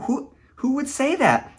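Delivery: pitched high in disbelief; stunned disbelief; incredulous. Real, not acted. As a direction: ask it as if you can't believe it. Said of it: "that's what always has amazed me".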